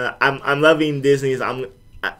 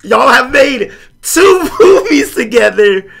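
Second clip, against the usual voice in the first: high, loud, slow, incredulous insistence; exasperated actors; 10 semitones higher, 8 dB louder, slower than usual. Retold or imitated. Actors telling Gwyneth about Samuel L. Jackson, high loud disbelief. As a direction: spell it out to her in disbelief. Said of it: "And they're like".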